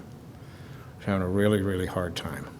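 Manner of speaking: real hurt pulling the voice down to almost nothing; choked-up quiet. Real, not acted. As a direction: let the really sink as the voice gives out. Repeats the line, then breaks down.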